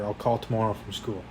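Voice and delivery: plain reading voice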